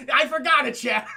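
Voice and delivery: high, loud, laughing exclamation; amused outburst. Real, not acted. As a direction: shout it while cracking up. Laughing at forgetting title The Forgotten.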